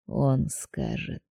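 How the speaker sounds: low, slow whispered threat; menacing whisper. A narrator's reading. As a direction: promise it in a whisper. Mage promises threat.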